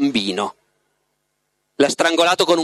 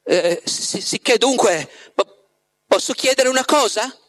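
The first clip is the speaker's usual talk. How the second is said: higher, hesitant, halting restarts; stumbling over words; 7 semitones higher than usual. A narrator's reading. Margherita nervously asking Voland for her wish, stammering. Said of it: "incespicando nelle parole".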